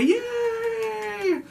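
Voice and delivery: a squealed high yay, dripping with irony; sarcastic cheer. Acted. Host mocking her own holiday, squealing a sarcastic yay. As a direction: cheer it high like fake joy.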